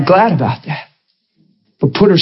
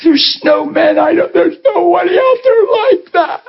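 higher, tearful protest; retold desperate cry; 10 semitones higher than usual. Girl in the office denying good men exist, mock tearful cry. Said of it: "she cried desperately and said".

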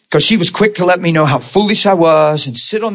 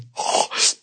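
sharp acted intake of breath; imitated gasp; 8 dB quieter than usual. Seeing the big syringe, acted gasp. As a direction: breath-only gasp of dread.

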